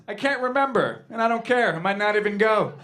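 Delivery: put-on high, loud, defiant old lady; elderly woman loudly shrugging it off; imitating grandma. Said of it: "This is what my grandma says".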